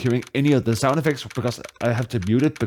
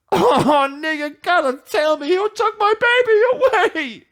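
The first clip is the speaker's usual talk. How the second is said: put-on wailing despairing boyfriend; 3 dB louder than usual. Grieving client wailing in the lobby, hammy high crying. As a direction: wail it like a melodramatic wreck.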